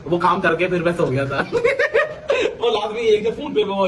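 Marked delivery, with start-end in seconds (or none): 1.5-2.5 high burst of laughter. Real, not acted. Laughs at his own story.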